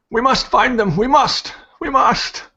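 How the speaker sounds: put-on high, loud urgent old priest's voice. Priest calling from the wagon to find them, urgent. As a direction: cry out urgently, pitched well up.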